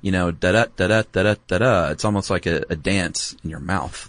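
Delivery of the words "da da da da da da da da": da-da chanted in a bouncing rhythm; sing-songy da-da rhythm. Shown as a demonstration. Podcast host chanting the beat of the pie sentence, playful. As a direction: tap out da-da like a little dance. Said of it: "sing-songy and sort of playful".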